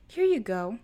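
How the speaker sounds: bright, loud sugary server voice; sweet waitress. A narrator's reading. Waitress serving the couple, loud sugary tone. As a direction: lay the sweetness on thick.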